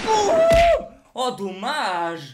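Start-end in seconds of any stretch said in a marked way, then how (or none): 0.1-0.9 much higher and louder yell. Real, not acted. Gamer alone at home yelling at the screen, loud. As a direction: blast it out in triumph. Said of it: "Désolé pour vos oreilles".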